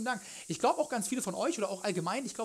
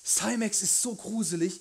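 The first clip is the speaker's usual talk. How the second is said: spooked; 2 semitones higher, slower than usual. Real, not acted. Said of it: "ich hab so Paranoia".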